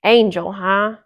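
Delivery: loud, sour grumble; grumpy dwarf, sour and scornful. A narrator's reading. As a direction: sneer it sourly as a grumpy dwarf. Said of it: "he said sourly".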